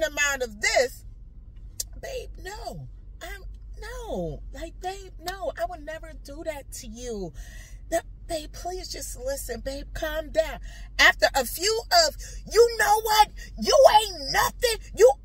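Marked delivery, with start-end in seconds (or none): 2.0-10.6 soft, high, coaxing reassurance; soothing plea. Retold or imitated. Wife begging partner to listen and calm down, soothingly. 12.5-15.1 loud, high acted tirade; angry insult. Partner belittling the wife in an argument, angrily.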